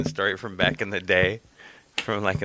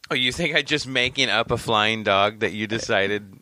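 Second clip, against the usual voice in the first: ordinary talk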